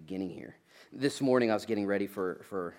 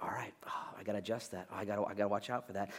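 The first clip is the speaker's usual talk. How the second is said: self-talk; 7 dB quieter, faster than usual. Acted.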